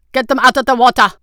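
loud, high shouted command; barked order. A narrator's reading. Guard captain to his men at the dock, shouted order. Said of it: "the guard captain ordered".

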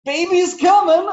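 high, loud teasing voice for the shirt; mock shirt slogan. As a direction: pipe it up like a cartoon slogan. Reading a silly maternity shirt.

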